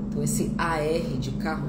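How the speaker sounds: normal talking voice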